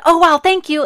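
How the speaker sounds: high, loud burst of relieved thanks; surprised thanks. Retold or imitated. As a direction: blurt out thanks and hang up fast. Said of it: "And I said".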